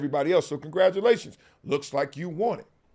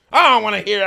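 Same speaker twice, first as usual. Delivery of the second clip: mock dismissive; 4 semitones higher, 8 dB louder, faster than usual.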